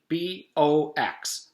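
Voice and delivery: slow letter-by-letter spelling. Acted. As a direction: spell each letter carefully.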